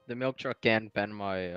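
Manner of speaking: usual speaking voice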